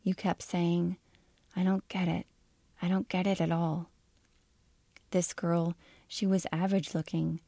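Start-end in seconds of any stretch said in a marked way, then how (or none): 1.5-3.9 flat droning voice. A narrator's reading. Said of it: "Your voice was like a machine ... heavy and flat and ran together".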